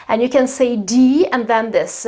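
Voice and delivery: ordinary delivery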